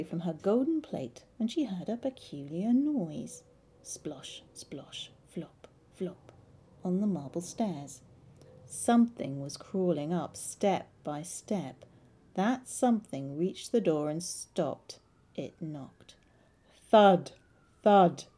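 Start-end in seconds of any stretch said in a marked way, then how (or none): 3.8-6.3 soft hushed splosh, flop; whispered squelching steps; whispered splosh sounds. A narrator's reading. Frog crawling up the stairs. 16.9-18.2 loud, falling thud, thud; loud knocking thuds; loud knocks. A narrator's reading. Frog knocking at the door.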